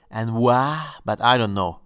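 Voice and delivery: usual speaking voice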